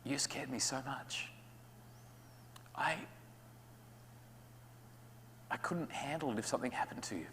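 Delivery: sincere, scared parent. Acted. Parent telling the child what they truly feel, softly. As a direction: confess your fear gently. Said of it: "what you're really saying".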